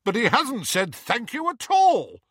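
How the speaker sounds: high-pitched outraged protest; indignant. A narrator's reading. Hestia contradicting the boy's gushing mother, indignant. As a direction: object in a high, affronted voice. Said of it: "said Hestia indignantly".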